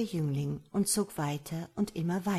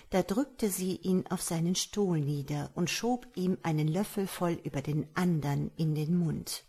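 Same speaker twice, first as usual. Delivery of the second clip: usual speaking voice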